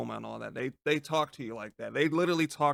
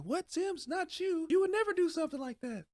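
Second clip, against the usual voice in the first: mocking listener voice. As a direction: play a shocked fan who can't believe it. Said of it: "I know you guys are thinking".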